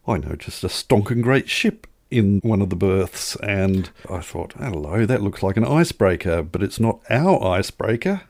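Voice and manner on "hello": ordinary talk, nothing marked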